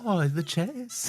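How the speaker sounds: put-on old Italian lady, slow and wailing; mock old Italian. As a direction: drag it out like a nonna. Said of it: "is that the Pope?".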